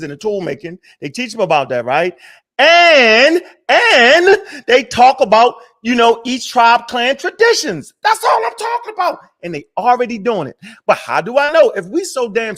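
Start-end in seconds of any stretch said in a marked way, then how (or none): 2.6-7.9 loud, high, slowed rant with a laugh; drawn-out emphatic heated burst; heated outburst. Real, not acted. Heated rant about ancestors. 8.0-9.2 exasperated yelp. Real, not acted. Climax of heated rant.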